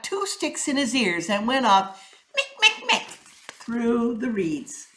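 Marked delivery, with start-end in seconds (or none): 2.3-3.1 mick mick sound. A narrator's reading. Sound of ears plugged walking.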